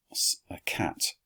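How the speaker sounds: soft whispered aside; whispered thought. Acted. Quintus thinking it privately, whispered. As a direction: breathe it out as a secret.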